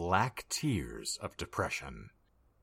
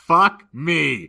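loud, high cry of frustration; real, loud exasperation; exasperated outburst; 9 semitones higher, 13 dB louder than usual. Real, not acted. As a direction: burst out cursing your own flub. Reader flubs, swears at self.

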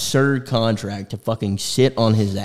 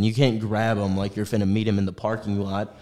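normal talking voice

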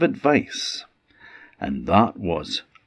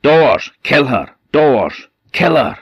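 loud, higher, fierce order; shouted command; 7 semitones higher, 6 dB louder than usual. A narrator's reading. Magic chest shouting at the doors to kill her. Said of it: "it shouted out to the doors".

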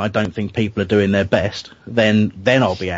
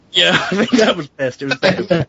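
laughing; 9 semitones higher than usual. Real, not acted. Laughing at the mic joke.

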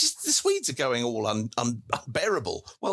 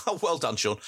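usual speaking voice

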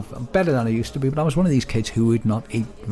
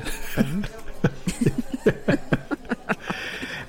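long, high real giggle; extended amused laughing fit; 8 semitones higher, 4 dB quieter than usual. Real, not acted. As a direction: dissolve into helpless chuckling. Amused at weird-tasting ice cream.

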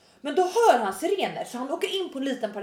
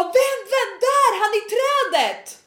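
high, frantic retold yell; acted high-pitched call-out; urgent shout; 9 semitones higher, slower than usual. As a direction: re-enact shouting directions to police cars. Yelling directions to police.

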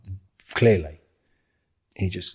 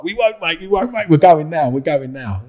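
high and louder, hurried reassurance; nervous placating; 9 semitones higher, 9 dB louder than usual. Retold or imitated. Actors told to stay away from Millwall, anxiously high. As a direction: back away fast, promising to leave. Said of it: "you was like".

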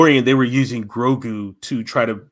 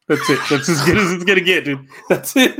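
laughing at own attempt; giggly, high and sheepish; 6 semitones higher than usual. Real, not acted. Laughing after own impression.

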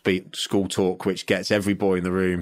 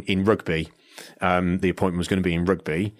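usual speaking voice

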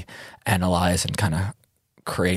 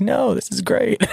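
high excited quote of himself; mock delighted tourist; 3 dB louder than usual. Playing tourist in his own home, high and excited. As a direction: squeal it like a thrilled tourist. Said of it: "I'm like".

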